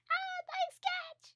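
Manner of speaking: very high, drawn-out sarcastic squeal; exaggerated falsetto jeer; mock pissed off. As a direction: shriek it slowly as a hammed-up annoyed woman. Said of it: "Mai being all pissed".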